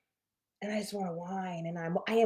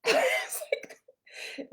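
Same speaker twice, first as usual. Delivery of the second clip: laughing; 3 dB louder, much slower than usual. Real, not acted. Laughing while retelling.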